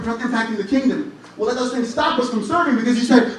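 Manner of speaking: ordinary talk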